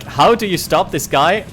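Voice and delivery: ordinary talk, nothing marked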